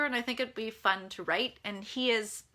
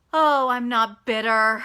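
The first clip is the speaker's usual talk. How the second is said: loud, drawn-out mock denial; sarcastic; 9 dB louder, slower than usual. Real, not acted. Author joking after venting about unpaid returns, sarcastic. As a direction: draw it out loudly with heavy irony.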